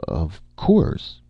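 half-hearted bravery; feeble bravery. A narrator's reading. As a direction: put on a faint show of courage. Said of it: "Martin said with feeble bravery".

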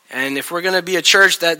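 ordinary talk

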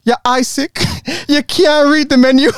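bad Jamaican accent, sharp. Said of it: "very bad Jamaican accent coming up".

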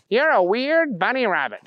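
high, goofy character voice; Booger character voice. A narrator's reading. Booger remarking on the talking bunny, high-voiced.

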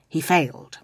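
ordinary delivery

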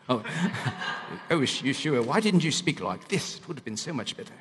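hammed-up British accent, playfully put on; mock British accent. Imagined admirer to Yeshua, in a mock British accent. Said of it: "if he had a British accent".

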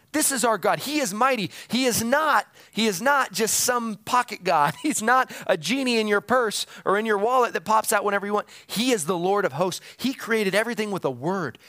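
impassioned preaching. Real, not acted. Preacher to congregation on God's might, impassioned preaching.

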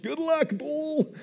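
high, slow, shrugging good luck; mock carefree quarterback. Quarterback tossing the ball away, slow and high. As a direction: toss it off like you don't care. Quarterback throwing a Hail Mary.

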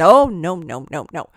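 loud first burst, then dropping away; emphatic mock refusal. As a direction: wave it off, imitating a friend's protest. Quoting friend's refusal to be recorded.

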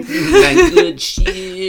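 high squeaky laugh through the words; laughing it out slowly. Real, not acted. Laughing echo.

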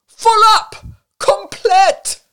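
high, loud exclamations; bellowed, desperate insistence; despairing roar. A narrator's reading. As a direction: roar your refusal desperately. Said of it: "he roared despairingly".

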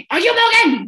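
high, loud mock scream; screaming boss. Owner interviewing a twenty-year-old bartender applicant, screamed. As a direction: yell it like an overbearing interviewer. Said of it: "Like, screaming at me".

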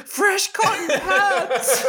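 very high, slow, laughing repeat; squeaky laughing parody of a confused drunk; mock bewildered drunk. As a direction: echo the phrase in a high, drawn-out, giggling disbelief. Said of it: "And she goes".

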